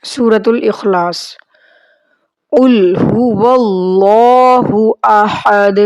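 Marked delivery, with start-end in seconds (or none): 2.5-5.8 slow, drawn-out Arabic recitation. Shown as a demonstration. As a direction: recite each word slowly and clearly. Teacher recites prayer slowly.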